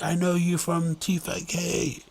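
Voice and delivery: high, drawn-out mock call; mock shout across street. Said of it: "shouting across the street".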